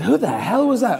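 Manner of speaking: high, incredulous demand; mock indignation. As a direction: ask it in exaggerated outrage. Retold indignant question in the pub.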